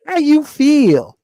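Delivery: high, slow, soft mocking greeting. Mocking how young brothers greet, high and slow. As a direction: mimic the soft new greeting. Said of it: "somebody says to you".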